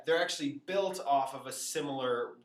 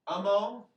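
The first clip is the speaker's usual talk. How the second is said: "Amo" said loud and high with a long final O; emphatic teacher's demo of "Amo"; highlighted ending; 6 semitones higher, 6 dB louder than usual. Shown as a demonstration. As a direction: draw out the O that ends "Amo". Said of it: "highlight again what's different".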